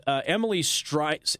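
normal talking voice

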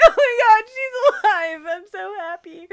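hammed-up tearful joy, half laughing; losing it emotionally. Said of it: "I lose it again".

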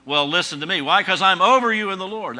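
hammed-up self-important authority; prideful leader. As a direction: pull rank on the congregation, loudly. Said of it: "That can become prideful".

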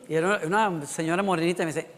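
ordinary talk, nothing marked

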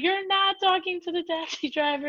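high-pitched imitation of mom correcting dad; exasperated correction. Mom correcting dad's mistake, acted.

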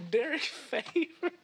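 high, slow, laughing question; laughing disbelief. Real, not acted. Co-host laughing at a wallpaper brag, high and slow.

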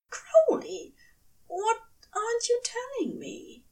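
high angel voice sinking to a whisper; hurt angel. Acted. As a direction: ask the question in a hushed, hurt whisper. Aziraphale demands.

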